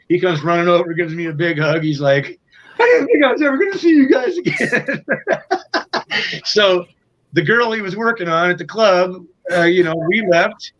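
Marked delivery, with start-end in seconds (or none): 2.8-5.1 high laughing voice breaking into laughter; laughing relief. Retold or imitated. Singer reunited with his bandmates, high and laughing. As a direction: laugh through the words, giddy.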